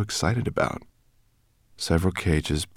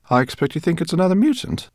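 higher, quick teasing professor; playful dig at a colleague, higher and quicker; Greenbloom teasing; 13 semitones higher, 5 dB louder, faster than usual. Acted. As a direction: needle him playfully. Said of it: "said Professor Greenbloom".

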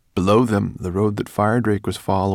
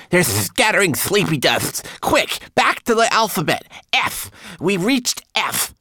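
very high, loud, urgent commands; urgent cry; 8 dB louder than usual. A narrator's reading. Twigleg warns friends of sleepy dust, urgent high cry. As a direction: shout the warning at a high pitch. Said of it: "cried Twigleg".

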